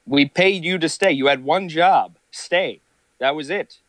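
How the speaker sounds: high, indignant scolding; mock scolding. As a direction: scold them like a boss who paid. Scolding the chatter.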